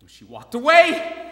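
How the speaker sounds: 2 shouted in sudden triumph; 3 burst out, can't hold it in; 4 near-scream of discovery; 1 excited outburst. Acted. Joy at nurse's routine confirmed.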